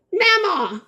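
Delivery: high, proud cry of the word; elated Mama; triumphant mama. Real, not acted. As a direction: shout Mama with joy. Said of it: "I did it".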